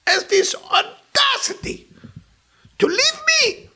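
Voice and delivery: high, loud indignant wail; imitating the friend's high outraged cry; outraged woman. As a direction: play the furious jilted woman. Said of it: "I said".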